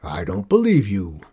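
a hint of a stern captain; flat refusal, mildly stern; lightly voiced stern captain. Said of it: "the captain said in a stern voice".

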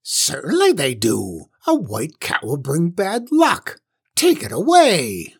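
swooping, indignant witch voice; angry old witch. A narrator's reading. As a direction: rule it out crossly, pitch leaping up.

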